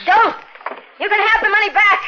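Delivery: high, fast, panicked begging; frantic pleading. Acted. Woman at knifepoint begs the killer, high and panicked. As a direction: offer anything to stop the knife.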